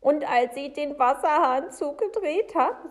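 high, loud telling; agitated Frau Segebrecht. Acted. Woman continues upset account.

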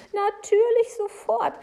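high, loud, insistent account; agitated Frau Segebrecht. Acted. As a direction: press on with the tale, very worked up.

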